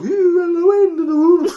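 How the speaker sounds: high, moaning wail; eerie whistling moan of the metal man; wind-blown voice. Acted. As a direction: let the wind moan through a hollow body. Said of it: "wind travelling through the bits".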